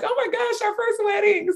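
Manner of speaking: excited squeal. Retold or imitated. The group celebrating its first wedding, squealing high. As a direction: squeal with joy over the news.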